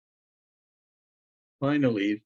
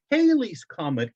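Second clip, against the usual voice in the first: put-on higher, eager boy's voice; young boy Loren; 7 semitones higher, 4 dB louder than usual. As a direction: play the son, bright and eager. Boy answers father.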